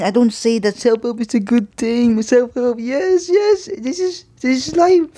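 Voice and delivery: high, wheezy put-on old voice; breathless old person. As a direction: gasp it out like a winded elder. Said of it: "catch my breath".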